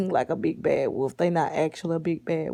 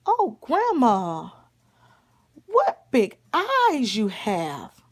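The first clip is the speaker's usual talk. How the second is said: loud, slow, drawn-out storybook marvel; hammed-up child's amazement; 3 semitones higher, 6 dB louder, slower than usual. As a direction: stretch each exclamation in big swooping wonder.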